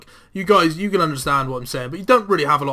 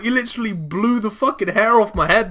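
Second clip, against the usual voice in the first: ordinary delivery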